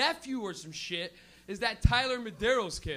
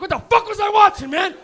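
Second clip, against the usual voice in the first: much louder and higher, laughing bewilderment; loud baffled cry; 7 semitones higher, 14 dB louder than usual. Retold or imitated. Stoner who forgot his TV show, loud baffled cry. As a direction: shout it out in stoned confusion. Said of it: "you sit there like".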